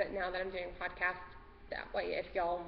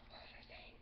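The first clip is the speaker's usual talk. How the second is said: voiceless murmur of lost thought; whispered to herself; 21 dB quieter than usual. Real, not acted. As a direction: mouth it to yourself, barely audible. Losing her thread, dogs around.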